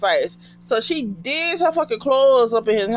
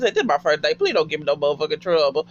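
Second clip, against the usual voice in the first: security guard voice; 6 semitones lower, much faster than usual. Retold or imitated. Guard's plea repeated, voiced.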